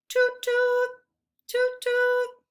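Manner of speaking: high, loud toot toot; horn honk. Shown as a demonstration. Teacher honking a horn for the class. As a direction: honk like a toy car horn. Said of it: "pretend to honk a horn".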